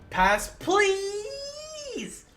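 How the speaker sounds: high whiny plea, hammed up; mock pleading. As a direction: whine it like a sulky character. Mocking character refusing to act.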